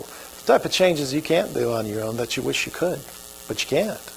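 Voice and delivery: ordinary delivery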